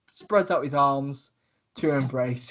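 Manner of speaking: ordinary talk, nothing marked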